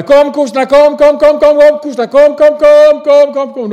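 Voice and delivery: high, loud cattle call; calling the cows. Retold or imitated. Mother calling the cows home from the forest, high loud call. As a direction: sing out across the forest to the cows. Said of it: "mor ut och ropade".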